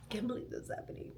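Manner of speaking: breathless whisper, fast; whispered disbelief. Retold or imitated. Echoing her own shock to her listeners, a fast whisper. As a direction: whisper it to yourself in awe. Repeating it to herself in awe.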